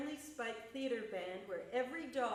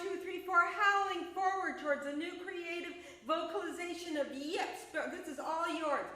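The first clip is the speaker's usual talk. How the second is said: higher, lively poem reading; dog poem performance; 6 semitones higher, 8 dB louder than usual. Acted. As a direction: play the eager dog, bouncing.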